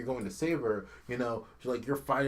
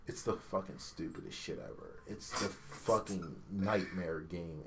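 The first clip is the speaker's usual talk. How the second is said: soft, drained, half-whispered; deflated mutter; 6 semitones lower, 7 dB quieter than usual. Real, not acted. Gamer deflated after ranting, soft and low. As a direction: let it sag into defeat. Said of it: "deflated after shouting".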